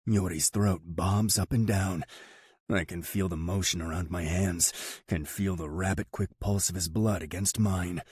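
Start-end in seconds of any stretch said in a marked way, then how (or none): none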